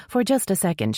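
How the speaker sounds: plain reading voice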